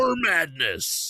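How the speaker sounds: hyped monster truck announcer. Pretend. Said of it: "you should do the monster truck ads".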